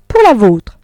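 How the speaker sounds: wife exposing her husband's mistress; crying out. A narrator's reading. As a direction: fling it at him as she stands. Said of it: "s'écria madame Arnould se levant toute droite".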